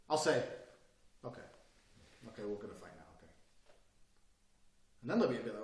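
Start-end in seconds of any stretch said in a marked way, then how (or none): 1.2-3.3 sudden drop to a soft, calm voice; calm and quiet. Retold or imitated. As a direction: coolly settle it, much softer. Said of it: "I'll change my body language completely".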